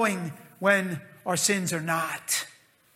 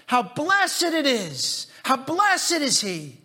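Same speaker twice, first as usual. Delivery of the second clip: joyful; 6 semitones higher, slower than usual. Retold or imitated. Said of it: "hear the joy in David's voice".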